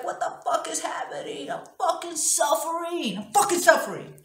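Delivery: high, strained reenacted outcry; crying and yelling. Woman reliving struggling up off the floor, crying and yelling. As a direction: wail it out, building in force. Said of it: "I'm yelling".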